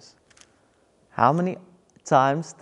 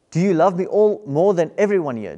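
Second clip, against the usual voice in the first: pressing question; 5 semitones higher, 7 dB louder than usual. Acted. Jesus pressing Peter.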